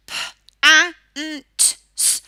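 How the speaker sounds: pants sounded out as p, a, n, t, s; sounding out words. Shown as a demonstration. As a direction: say each sound apart: p, a, n, t, s. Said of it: "even if you say".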